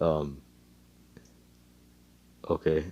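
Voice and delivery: soft, low, halting reply; long stunned pause before answering; a little dumbfounded. Acted. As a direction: mumble it, unsure. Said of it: "looks at her a little dumbfounded".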